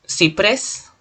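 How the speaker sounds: "Ciprés" with weight on "prés"; stress on last syllable. Shown as a demonstration. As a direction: lean into the final "prés" of "Ciprés". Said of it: "la mayor fuerza de voz en pres".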